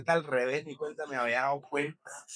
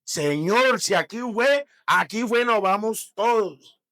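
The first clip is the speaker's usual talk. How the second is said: loud desperate prayer; 5 semitones higher, 10 dB louder, slower than usual. Retold or imitated. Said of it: "Y se".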